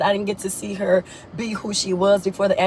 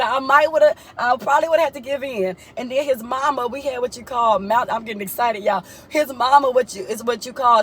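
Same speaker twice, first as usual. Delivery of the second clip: ordinary talk, nothing marked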